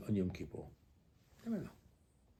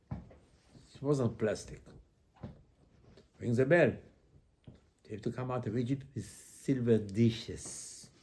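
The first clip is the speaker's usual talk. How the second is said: ordinary talk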